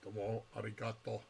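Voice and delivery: low, quiet robot voice. Pretend. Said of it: "in a robotic voice".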